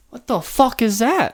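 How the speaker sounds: slow, high, incredulous "what the fuck"; a put-on stunned onlooker; mocking disbelief. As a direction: act baffled at the sight, drawn out. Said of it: "you're like".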